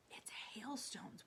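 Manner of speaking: slow, breathless whisper of discovery; excited whisper. A narrator's reading. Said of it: "whispered James excitedly".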